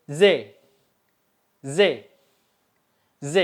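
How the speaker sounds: "Z" as a buzzing "zhe", the Turkish j; agak berdesis. Shown as a demonstration. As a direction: say "Z" like zé, slightly sibilant. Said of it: "agak berdesis, mirip huruf Z". ("Z" agak berdesis, mirip z Indonesia)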